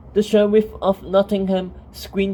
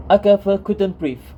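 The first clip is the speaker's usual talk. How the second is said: plain reading voice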